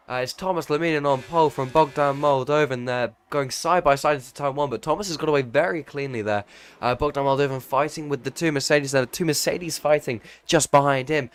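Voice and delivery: loud, high, excited race-start commentary; live play-by-play. Real, not acted. Commentator calling lights out in Jeddah, excited play-by-play.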